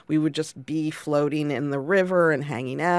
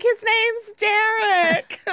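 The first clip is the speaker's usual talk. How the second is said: very high, drawn-out holler; excited shout back; 3 dB louder, much slower than usual. Retold or imitated. Unicorn boys answering the floaters, high excited holler. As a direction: call it back high and stretched out.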